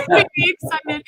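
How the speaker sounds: a laugh breaking into the words. Real, not acted. Host telling about backstage dancing, laughing. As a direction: giggle mid-sentence.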